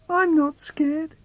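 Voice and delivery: high, slow, shaky-brave reassurance; child talks himself brave in the dark; falsely brave. Acted. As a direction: insist you're not scared while clearly scared. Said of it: "So I have to say".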